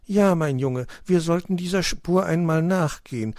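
high-pitched motherly storybook voice; hedgehog mother, confirming. A narrator's reading. Mother recognising the scarf she knitted, assured.

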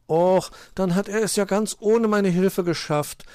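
high-voiced mild letdown; a little disappointed. A narrator's reading. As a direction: sulk slightly in a small, high voice. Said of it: "ein wenig enttäuscht".

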